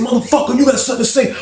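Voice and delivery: high retold dare; confrontational challenge. Acted challenge to a fight.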